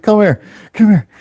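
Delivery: high, hurried imitation of a friend; urgent beckoning. Friend sheltering the culprit away, urgently. Alex pulls him away.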